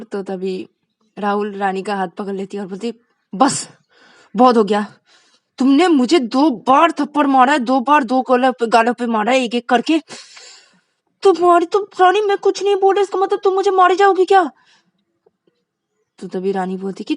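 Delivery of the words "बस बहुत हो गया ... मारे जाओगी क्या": loud, rising to high fury; angry confrontation. Acted. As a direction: had enough of the slaps. Vikas finally confronts Rani. (गुस्से में सामना)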